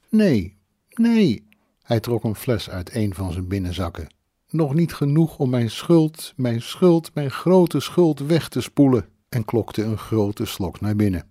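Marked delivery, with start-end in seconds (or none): 0.1-1.5 high, louder acted protest; wailed denial. A narrator's reading. Drunk man protests in narrated quarrel. 4.5-9.0 raised-pitch, anguished self-reproach; guilty lament. A narrator's reading. Drunken man laments his guilt.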